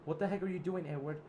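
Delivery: high, loud indignant demand; outraged protest. Acted. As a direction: push back hard at the attacker. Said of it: "And Zip said".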